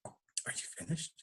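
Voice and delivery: whispered, deep and dry; calm voice. Retold or imitated. The divine voice cutting short the man's rant, whispered. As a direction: ask it softly, almost bored. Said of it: "The voice said".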